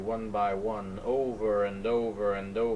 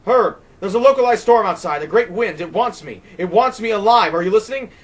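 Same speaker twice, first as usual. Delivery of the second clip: much higher, much louder desperate pleading; terrified plea; 11 semitones higher, 14 dB louder than usual. Acted. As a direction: beg him to understand the danger. Terrified plea over the phone.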